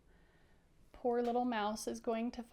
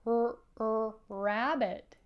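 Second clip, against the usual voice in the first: "er, er, rabbit" with a stretched R onset; sounding out the first letter; R emphasis; 5 dB louder, slower than usual. Shown as a demonstration. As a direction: lean on the R before "rabbit". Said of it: "Can you do it?".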